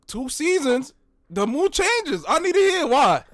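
rising high with excitement; excited. Real, not acted. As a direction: build the hype up. Building hype about Westbrook.

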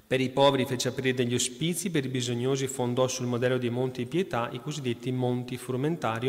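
lettura solenne, ritmo regolare; lettura agiografica. Acted. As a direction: racconta la vita del santo al pubblico. Reads saint's life aloud. (lettura devota ad alta voce)

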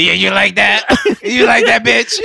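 loud, high hammed-up bedroom taunt; sex voice. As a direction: ham up a crude bedroom line. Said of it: "deep voice or the soft voice".

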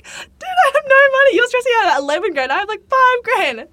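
high, loud wail; half-laughing mock sob; retold tearful outburst. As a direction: lament with a break in it.

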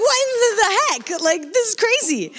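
very high-pitched astonished exclamation; amazed disbelief. Retold or imitated. Stranger buys her a tent on the plane, astonished. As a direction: exclaim it high, reliving the shock.

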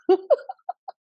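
short high giggle, real; a quick bright laugh at her own joke; laughing at her own remark; high amused laugh. Real, not acted.

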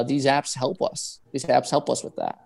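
ordinary talk, nothing marked

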